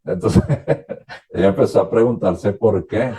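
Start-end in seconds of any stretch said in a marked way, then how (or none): 0.5-1.3 quiet breathy laugh. Real, not acted. Amused while retelling.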